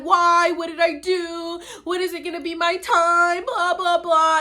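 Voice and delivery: high, whiny mock despair; mock whine. Mocking her past self moping over lost TV chances. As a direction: whine it like a sulky teen. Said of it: "then I would be sad".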